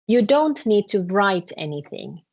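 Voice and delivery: usual speaking voice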